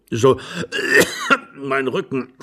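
put-on old man choking out words between coughs; acted, broken-up, coughing old husband; coughing fit. A narrator's reading. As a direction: groan about it through a coughing fit. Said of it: "und er hustete".

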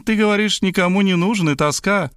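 high, sly teasing voice; playful teasing. A narrator's reading. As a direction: tease him playfully. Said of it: "игриво толкнула меня в бок".

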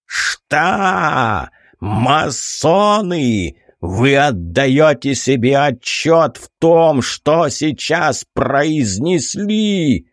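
thunderous rage. A narrator's reading. Император обрушился на адъютанта.

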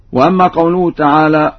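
ordinary talk

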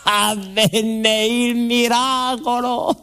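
slow, high wonder-struck announcement; awed reveal. Acted. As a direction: draw out the miracle in awe. Miracle announced slowly, theatrically.

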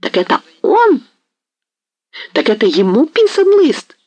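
the hetman exploding at the merchant; enraged disbelief. A narrator's reading. Hetman realising the betrayal.

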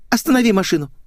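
high, urgent little voice; anxious girlish voice. A narrator's reading. Girl wants out of the car, high urgent voice. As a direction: demand it, pitched high.

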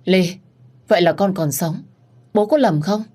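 high, startled disbelief; shocked father. Acted. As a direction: gasp it out like seeing a ghost. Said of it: "Bố tôi hốt hoảng". (người cha hốt hoảng sững sờ)

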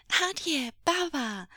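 put-on high, loud happy child; joyful high-pitched shout; delighted calling out. A narrator's reading. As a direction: call to your dads in delight. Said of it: "calling out in delight".

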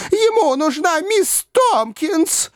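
high, slow, drawn-out shriek; panicked girl. A narrator's reading. A girl shrieks about Miss Tompkins, panicked and slow. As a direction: wail it in alarm.

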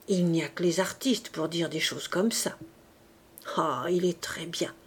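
confiding whisper; whispered aside. A narrator's reading. Favourite whispering to Dahlia about her admirer, hushed. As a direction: breathe it like a secret to a friend.

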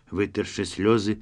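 usual speaking voice

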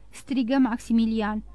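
usual speaking voice

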